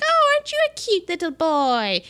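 high, lightly voiced fawning witch; a hint of a sugary old witch; witch cooing. Witch from Wizard of Oz coos at Ralphie, lightly voiced mock sweetness. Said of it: "a witch from the Wizard of Oz comes up to Ralphie".

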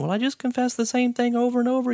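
mock complaining student; whiny complainer. Teacher mimicking students who complain about confession, whiny. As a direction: imitate a whiny kid griping, quick and high. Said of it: "people complain".

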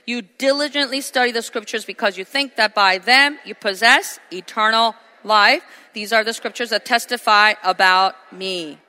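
loud, ringing pulpit delivery; full-force preaching. Acted. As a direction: proclaim it to the whole congregation. Preaching the scripture verses.

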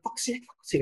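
put-on high child voice repeating the swear; child tattling. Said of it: "she would run into her mum and went".

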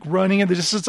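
normal talking voice

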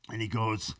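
ordinary delivery

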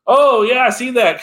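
high, loud, pointing-at-the-screen excitement; like DiCaprio pointing at the TV, thrilled; excited recognition. Retold or imitated. Fan spotting a callback in the movie, excited and loud. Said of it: "Leonardo DiCaprio meme ... going".

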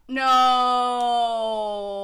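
loud, long drawn-out "No"; scandalized disbelief. Real, not acted. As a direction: cry out, stretching the vowel in gleeful horror. Shocked reaction to story's reveal.

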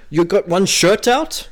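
put-on botched Scottish accent. Podcaster trying a joke on his co-host, botched Scottish accent. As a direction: attempt a Scottish accent and miss. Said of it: "I can't do Scottish".

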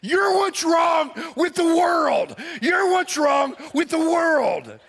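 screaming after her. Retold or imitated. Pastor yelling after the woman driving off, loud slow scream. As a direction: hurl it after the car. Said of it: "I'm screaming at her".

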